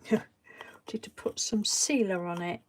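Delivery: whispered laugh. Real, not acted. Laughing aside to herself.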